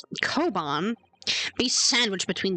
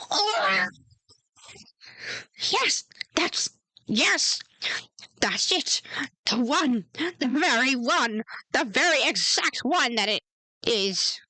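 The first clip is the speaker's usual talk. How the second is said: excited gasp; 5 semitones higher than usual. Acted. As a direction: gasp then gush with joy. Old man recognises his treasure.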